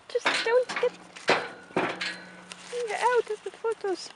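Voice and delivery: high, slow put-on pony voice; pony's voice. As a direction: voice the pony shooing you off. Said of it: "she's like".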